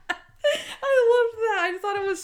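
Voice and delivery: can barely get it out for laughing; laughing hard. Real, not acted. Host laughing at memory.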